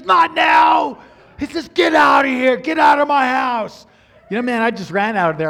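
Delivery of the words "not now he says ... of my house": loud, high shouting father; angry shout. Retold or imitated. Angry father chasing the suitor out, shouting. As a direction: bark him out of the house.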